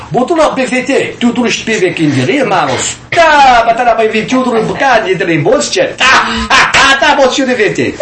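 high, excited mock tongues; speaking in tongues out loud. Man re-enacting his phone prank for eavesdroppers, loud mock tongues. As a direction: reenact a loud burst of foreign-sounding syllables. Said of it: "I just started speaking in tongues out loud".

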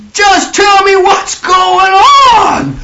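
high, loud, slow pleading cry; desperate plea. Retold or imitated. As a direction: cry out to be told what is happening. Pleading, imagined disciple's confusion.

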